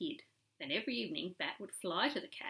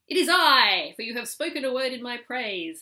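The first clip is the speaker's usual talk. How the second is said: triumphant; 5 semitones higher, 14 dB louder than usual. A narrator's reading. Cat's triumphant return.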